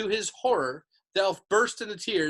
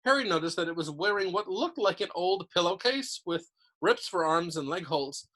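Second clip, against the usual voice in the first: ordinary delivery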